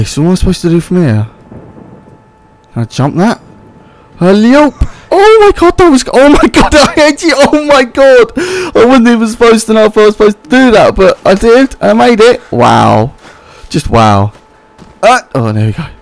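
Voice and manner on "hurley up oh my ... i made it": high, loud thrilled babble; excited. Real, not acted. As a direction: gush with triumph. Thrilled after making a jump.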